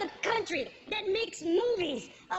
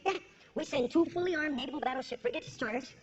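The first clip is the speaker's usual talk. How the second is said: usual speaking voice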